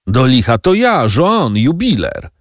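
high, impatient call to be let in; calling through door. A narrator's reading. (wołanie zza drzwi, wysokim głosem)

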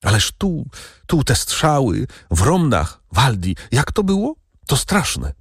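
high, anxious cry of worry; frightened girl blurting questions; fearful exclaim. A narrator's reading. Lucia asking Waldemar about the shootings, high and fearful. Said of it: "zawołała z lękiem".

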